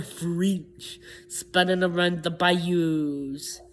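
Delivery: slow Louisiana French-tinged lilt; slow Cajun French accent. Shown as a demonstration. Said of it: "more of that French".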